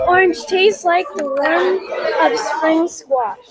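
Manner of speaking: ordinary talk, nothing marked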